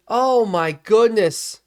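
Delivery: slow, high, loud dismay; drawn-out groaning disbelief; real exasperation. Real, not acted. Dismay after a blown play.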